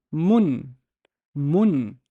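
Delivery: "mun" with a short u as in put; quick short vowel in "mun", closing on the n; short vowel demo. Shown as a demonstration. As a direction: keep the u of "mun" brief. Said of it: "Contrast it to number two".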